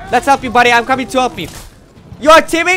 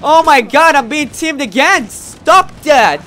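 loud, exasperated outburst at the screen; real gamer rage; annoyed yelling; 5 semitones higher, 9 dB louder, slower than usual. Real, not acted. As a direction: yell in frustration. Getting teamed on in game.

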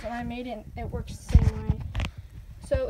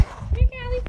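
high, louder coaxing call; calling a pet; 12 semitones higher, 6 dB louder than usual. Real, not acted. Owner calls Callie over for a trick, high coaxing call.

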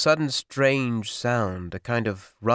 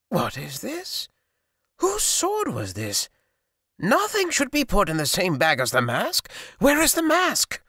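sorcerer rising alarm; 11 semitones higher than usual. A narrator's reading. Sorcerer finds sword with mask.

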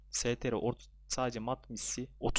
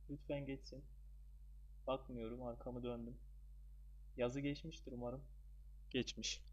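very soft hopeful murmur; hushed pleading; 10 dB quieter than usual. Real, not acted. Gamer with back turned, hoping the diamond passes, hushed. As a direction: plead under your breath, braced for the result.

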